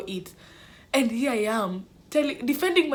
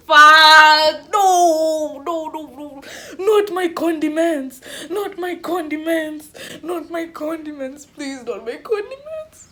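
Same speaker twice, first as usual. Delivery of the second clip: high mock wail of dread; hammed-up pleading; 7 semitones higher, 11 dB louder, slower than usual. Student begging the deputy to spare her condiments, hammed-up pleading. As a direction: plead desperately, high and drawn out, starting loud.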